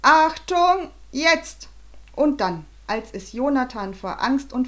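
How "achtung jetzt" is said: loud, high cue to fire; sharp raised-voice order; shouted command. A narrator's reading. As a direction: shout the warning, then the go. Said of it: "eine vermummte Stimme rief".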